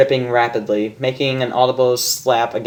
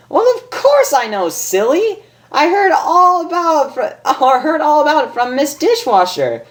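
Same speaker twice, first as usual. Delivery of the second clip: much higher giggly gossip; Pinkie Pie voice. Acted. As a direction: spill a secret with glee.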